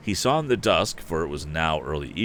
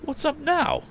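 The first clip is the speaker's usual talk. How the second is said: surprised. A narrator's reading.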